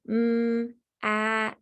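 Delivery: each letter sound said separately, "Mm" held, then "at". Shown as a demonstration. Teacher modelling sounding out for a student, separate sounds. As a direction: stretch out "Mm" and "at" like tapping letters. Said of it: "this teacher would help the student by saying".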